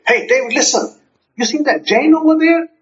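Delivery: high-pitched, animated phone call; excited. Acted. Telling David about neighbor Jane, excited. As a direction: share hot gossip with a friend. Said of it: "see how the statement changes".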